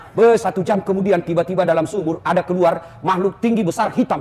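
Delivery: ordinary delivery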